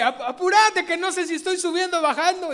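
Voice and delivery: high, loud, hurried plea; impatient old-woman shout. Acted. Old woman stuck on the stairs urging her sister, high loud shout.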